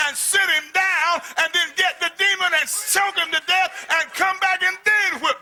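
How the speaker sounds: high, loud, shouting; booming preacher shouting at the congregation; fiery preaching. Acted. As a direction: drive it home with full force. Preaching at full force.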